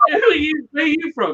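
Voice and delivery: high, sharp interrogating voice; mock locals questioning. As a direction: grill the stranger in a high put-on voice. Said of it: "Then we're like".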